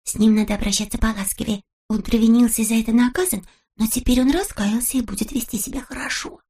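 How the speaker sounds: plain reading voice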